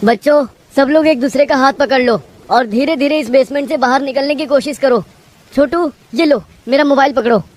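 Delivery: high, tense instructions; urgent teacher. Acted. Teacher gets children to hold hands in flood, urgent.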